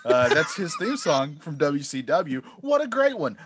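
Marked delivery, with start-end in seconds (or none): 2.6-3.4 mock enthusiasm, pitch jumps high; sarcastic praise, tongue in cheek. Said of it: "I'm being sarcastic".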